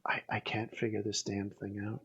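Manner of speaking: frustrated whisper to himself. Real, not acted. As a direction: whisper it under your breath, annoyed. Fumbling with light, aside.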